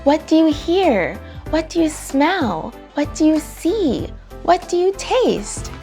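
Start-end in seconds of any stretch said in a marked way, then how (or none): none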